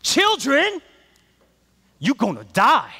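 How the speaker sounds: high, loud preacher's call. Retold or imitated. Pastor warning the students, loud preaching. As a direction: hammer it at the children like a preacher. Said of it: "He'll just say".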